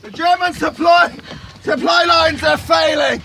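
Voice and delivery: loud excited shout. Acted. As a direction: yell the news over battle. Shouted battle report.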